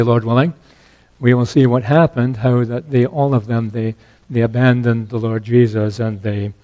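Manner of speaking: ordinary talk, nothing marked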